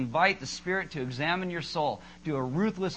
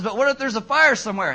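louder, higher, pressing worry; worried wife; 5 semitones higher, 9 dB louder than usual. Retold or imitated. Wife worrying about a fire at night, urgent. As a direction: insist there could be real danger. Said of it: "She says".

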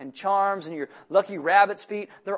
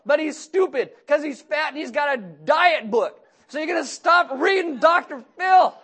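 higher and louder, shouting orders at each one; Jacob scolding his family; 5 semitones higher, 3 dB louder than usual. Acted. Jacob shouting new rules at his sons, wives, daughter. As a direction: bark house rules like a fed-up father.

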